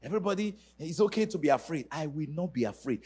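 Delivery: ordinary delivery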